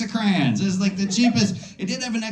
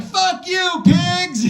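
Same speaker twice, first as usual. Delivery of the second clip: high, loud mock yell at the cops; angry shout; 13 semitones higher, 6 dB louder, much slower than usual. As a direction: bark it out the car window. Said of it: "She's just like".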